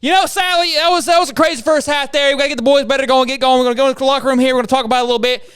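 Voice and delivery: high, loud, fired-up halftime coach; hammed-up, fired-up sideline coach; charismatic coach interview. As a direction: rattle it off like a pumped coach. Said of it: "I'm gonna do it real quick".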